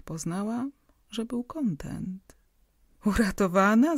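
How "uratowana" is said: a louder, joyful eager question; joyful call. A narrator's reading. Asking the doctor if the patient is saved, joyful call. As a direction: call out gladly, hoping for good news. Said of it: "zawołał radośnie".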